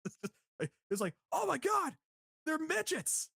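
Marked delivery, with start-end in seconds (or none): 1.3-3.3 high-pitched mock astonishment; Michael Cole. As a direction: imitate an over-excited commentator crying out. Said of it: "Michael Cole was like".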